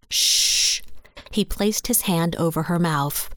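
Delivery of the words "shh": unvoiced "shh"; a firm shushing sound; sharp hush. A narrator's reading. Man hushing the loud woman.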